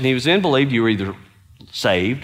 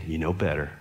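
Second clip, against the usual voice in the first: much softer, lower warning; a quiet reproach from God; hushed, low rebuke; God's voice; 8 semitones lower, 9 dB quieter, faster than usual. Retold or imitated. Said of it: "God was saying".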